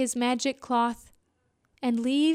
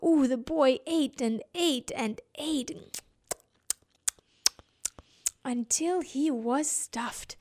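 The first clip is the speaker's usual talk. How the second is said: drawn out slowly; slow, relishing telling of the meal; slow, savouring; 3 dB quieter, slower than usual. A narrator's reading. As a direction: savour every word of the feast. Savouring narration.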